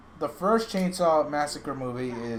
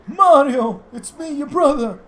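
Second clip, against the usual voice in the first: much higher, louder cartoon brother call; squeaky Luigi; 13 semitones higher, 12 dB louder, slower than usual. Luigi calling out to his brother Mario, high cartoon voice.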